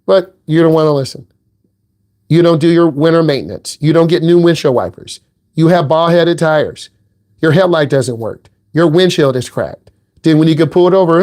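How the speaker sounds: normal talking voice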